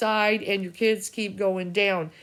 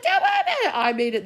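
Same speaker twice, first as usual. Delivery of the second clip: much higher, louder cartoonish brush-off; mock breezy reply; 13 semitones higher, 4 dB louder, faster than usual. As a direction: toss it off in a squeaky put-on voice. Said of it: "I'm like".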